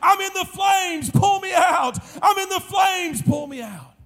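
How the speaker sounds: high, drawn-out cry, falling away at the end; retold dying scream. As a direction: act out a man crying out from the flames. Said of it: "scream at the top of his lungs".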